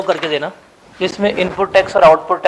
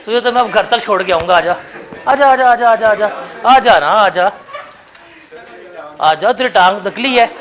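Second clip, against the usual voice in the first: louder, higher, playful urging to come up; loud coaxing call; 3 semitones higher, 6 dB louder than usual. Real, not acted. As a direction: call him to the front, loudly teasing. Teacher loudly calling student forward. (ज़ोर से मज़ाक में बुलाना)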